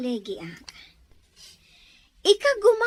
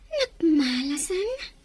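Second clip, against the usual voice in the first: crying; slower than usual. Acted. Woman laments alone, crying.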